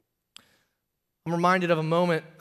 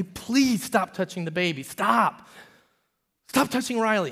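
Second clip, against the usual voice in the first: exasperated scolding; 5 semitones higher than usual. Retold or imitated. Father repeatedly scolding toddler.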